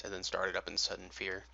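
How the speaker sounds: ordinary delivery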